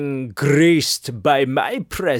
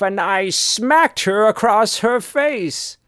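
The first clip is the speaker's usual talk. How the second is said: usual speaking voice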